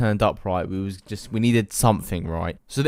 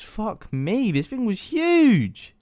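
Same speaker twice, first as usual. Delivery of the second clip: much higher, drawn-out disbelief; shocked; 10 semitones higher, much slower than usual. Real, not acted. Telling viewers how huge the dormouse was, higher and slow. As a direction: let the size stun you.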